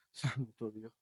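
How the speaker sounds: very soft murmured awe; hushed exclamation. Acted. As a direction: breathe it out under your breath. Whispered aside between the dialogue. (exclamación susurrada)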